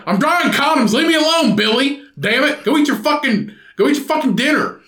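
put-on annoyed high dad voice, brushing kid off; irritated dad. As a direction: snap at the kid to go away. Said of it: "He's like".

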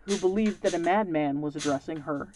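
usual speaking voice

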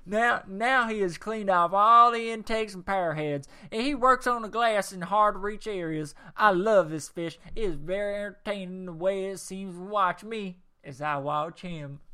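loud put-on Texas accent, easing off toward the end. Continuing Ted from Houston's review in a Texas accent. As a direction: carry on as the hammed-up Texan. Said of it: "do my Texas accent a little bit more".

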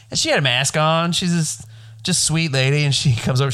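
normal talking voice

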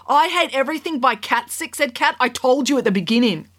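put-on high, indignant young girl. A narrator's reading. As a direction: snap back like an offended kid. Kat answering the judge.

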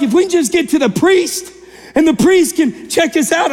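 high-pitched, excited imitation of the lepers; eager lepers. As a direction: act out hopeful men rushing to be cleared. Voicing lepers eager for healing.

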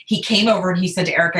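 ordinary talk

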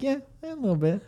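high, soft, coy little voice; coy false modesty. Acted. Pool shark answering an impressed opponent, coy false modesty. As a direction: play it cutely modest, high and small. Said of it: "And I'll be like".

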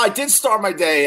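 ordinary talk, nothing marked

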